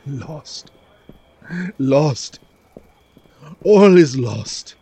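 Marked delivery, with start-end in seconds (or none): 0.1-0.7 hushed, hopeless murmur; despairing whisper. Acted. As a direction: barely breathe the word.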